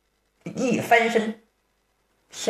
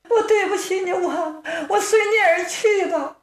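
high, sobbing lament, voice breaking; a sister crying that she will follow him; weeping sister; 10 semitones higher than usual. Acted. Sister weeping over her brother before taking her life, high and sobbing. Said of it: "瞅着姑娘哭".

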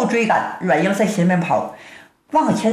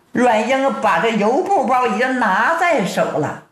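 usual speaking voice

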